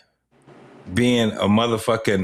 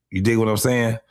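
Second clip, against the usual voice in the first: usual speaking voice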